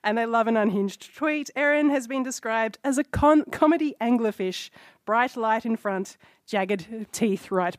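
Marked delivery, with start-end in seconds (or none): none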